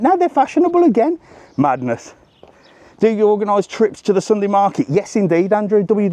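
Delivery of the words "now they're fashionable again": incredulous. Real, not acted. Marvelling at fashion change.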